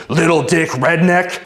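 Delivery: high-pitched, drawn-out mock taunt; imitating shouting woman. Woman shouting insult at man recording, mock yelling. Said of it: "shouting at me".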